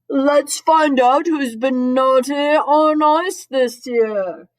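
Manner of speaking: big, drawn-out sleuthing declaration; detective Santa voice. A narrator's reading. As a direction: announce it grandly as storybook Santa. Detective Santa line read aloud.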